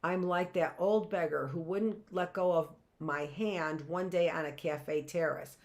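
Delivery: ordinary delivery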